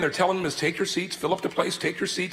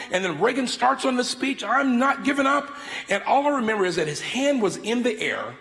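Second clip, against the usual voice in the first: ordinary delivery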